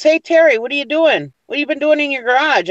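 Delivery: a neighbor calling out, half accusing; nosy dog catcher. Retold or imitated.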